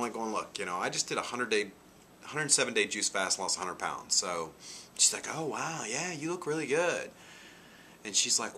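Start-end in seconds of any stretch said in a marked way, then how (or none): 5.3-7.1 high, bright mock compliment; lightly voiced young woman gushing; imitating the girl. Quoting the girl's compliment.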